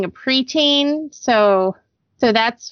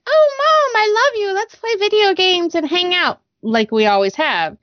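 sweet daughter; 8 semitones higher than usual. Retold or imitated. Mom voicing her sweet daughter.

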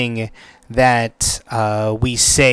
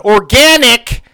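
"Organic!" yelled out much higher and louder; mock scream; 10 semitones higher, 7 dB louder than usual. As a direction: blurt "Organic!" out of nowhere like a kid in class. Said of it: "screams out".

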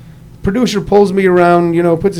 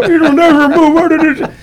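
a hint of a slow, higher pitiful groan; badly faked tragic strain, drawn out; mock pitiful; 10 semitones higher, much slower than usual. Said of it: "contort your face and go".

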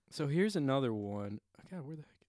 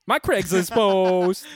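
mock proud; 6 semitones higher, 13 dB louder, much slower than usual. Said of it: "Woo woo".

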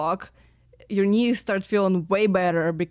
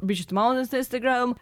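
usual speaking voice

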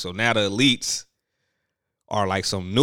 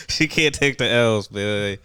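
normal talking voice

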